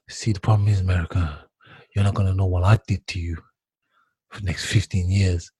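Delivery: low, quiet villain menace; sinister villain imitation. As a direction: growl it low like a movie villain. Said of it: "like a villain".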